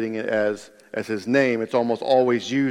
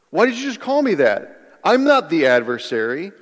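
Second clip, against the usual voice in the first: high-pitched, affronted question then denial; taken aback and objecting; indignant protest; 4 semitones higher than usual. Acted. Peter answering the rebuke, indignant protest. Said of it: "wouldn't that be your response?".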